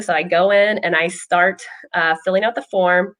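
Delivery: ordinary talk, nothing marked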